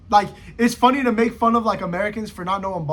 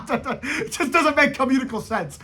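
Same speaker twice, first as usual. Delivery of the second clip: laughing; 6 semitones higher than usual. Real, not acted. Laughing at own joke.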